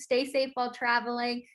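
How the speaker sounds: usual speaking voice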